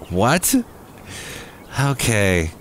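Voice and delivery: ordinary delivery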